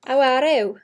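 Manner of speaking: drawn-out Welsh greeting, teasing; imitating the accent people find obnoxious; obnoxious accent imitation. Shown as a demonstration. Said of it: "Like that".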